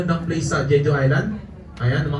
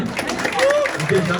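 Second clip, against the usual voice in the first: laughing; much slower than usual. Real, not acted. Laughing at the mix-up.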